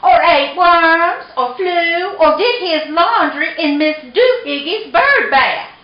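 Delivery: high, hammed-up scolding list; scolding mama cat. A narrator's reading. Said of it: "she scolded".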